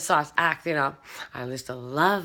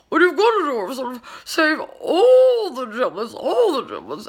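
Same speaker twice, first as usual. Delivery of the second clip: put-on high, loud Julia Child voice with a warble; sing-song celebrity chef voice; Julia Child impression; 8 semitones higher, 5 dB louder than usual. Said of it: "I used to talk like her".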